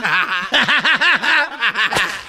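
long derisive laughter; mocking laugh. Official daring the donkey to transform, mocking laugh. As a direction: keep laughing at the absurd claim.

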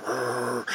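soft rolled villain growl; lurking growl. Retold or imitated. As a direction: growl quietly like a lurking monster. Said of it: "standing on the steps just lurking there".

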